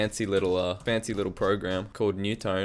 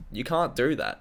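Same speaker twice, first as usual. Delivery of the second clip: ordinary delivery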